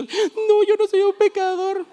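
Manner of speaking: very high, squeaky and loud; squeaky denial. Retold or imitated. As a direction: protest like a cartoon innocent. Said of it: "Y yo". (negación chillona muy aguda)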